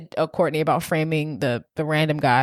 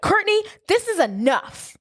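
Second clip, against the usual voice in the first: high, pushed stand-up line; imitating a firm friend; 13 semitones higher than usual. Julie confronting Courtney in the hallway, high and firm. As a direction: call her out sharply. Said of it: "then Julie's like".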